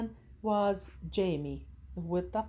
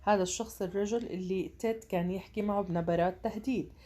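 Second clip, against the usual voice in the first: ordinary talk, nothing marked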